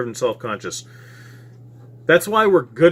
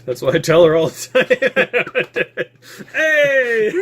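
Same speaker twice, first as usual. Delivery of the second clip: laughing through the words; cracking up mid-line; 10 semitones higher, 4 dB louder, much slower than usual. Real, not acted. Laughing at sex joke.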